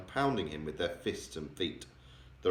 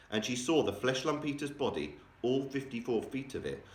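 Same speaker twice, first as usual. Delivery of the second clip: ordinary delivery, nothing marked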